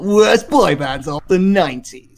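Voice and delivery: hammed-up radio presenter, swooping on the words; mock your own announcer delivery with a big swoop; Radio One voice. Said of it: "in a Radio One voice".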